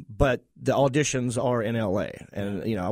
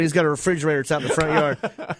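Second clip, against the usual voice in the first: usual speaking voice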